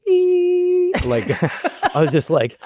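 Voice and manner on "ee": tiny high squeal; an inner squeal of delight, much higher; high-pitched excited eek; squeal of joy. Retold or imitated. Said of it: "I heard".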